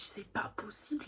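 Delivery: breathy whispered aside to herself; whispered thought. Retold or imitated. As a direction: whisper it in disbelief. Inner thought, whispered disbelief.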